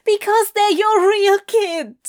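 high, loud, choked-up outburst; thick-voiced upset. Adam confronting Crowley about the real kid, choked up. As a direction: force it out past a tight throat. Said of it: "Adam said thickly".

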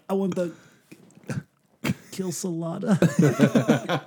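halting, high-pitched fumble of Kilsolada, drawn out slowly; "Kilsolada" garbled slowly in place of quesadilla; botched pronunciation. Retold or imitated. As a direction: stumble into "Kilsolada" after a long hesitation, mangling quesadilla. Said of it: "I couldn't pronounce it".

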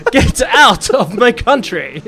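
loud, high, drawn-out British accent; slow, emphatic British delivery on request. Shown as a demonstration. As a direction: proclaim it in hammed-up British. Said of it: "in British?".

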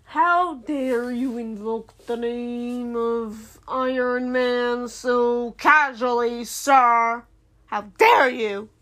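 slow, drawn-out grand indignation; hammed-up righteous scolding; mock-outraged. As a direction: stretch every word in theatrical offence. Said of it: "I was really mad".

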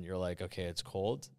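ordinary talk, nothing marked

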